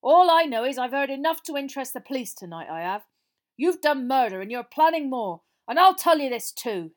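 loud, high accusing outburst; defiant, gaining confidence. A narrator's reading. Frank threatening the killer with police, loud defiance. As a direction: accuse him boldly at full voice. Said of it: "his voice growing steadier".

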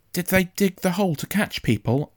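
put-on high-pitched boy's voice, concerned; worried young boy. A narrator's reading. Boy checking on his injured father, worried. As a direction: ask as an anxious child.

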